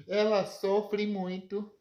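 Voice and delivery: plain reading voice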